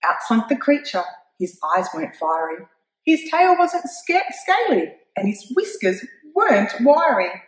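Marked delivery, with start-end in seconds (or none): none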